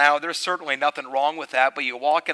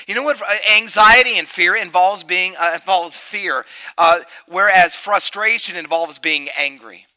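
much louder and higher fervent sermon voice; full-force preaching; 6 semitones higher, 8 dB louder than usual. Acted. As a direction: preach it out to the whole room.

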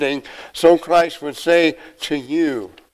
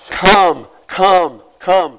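loud, higher "come" three times; called out; 4 semitones higher, 7 dB louder, much slower than usual. Acted. Preacher voicing the Spirit's call, loud.

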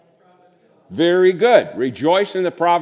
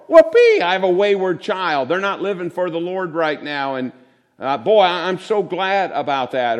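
sarcastic whoopee; 2 semitones higher, 3 dB louder than usual. Retold or imitated. Preacher mocks a churchgoer cheering at lymphoma, sarcastic shriek. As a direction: fake joy at bad news, hammed up.

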